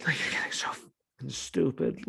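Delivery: hushed, voiceless jab; exasperated whisper; whisper aside. Real, not acted. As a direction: whisper it under your breath.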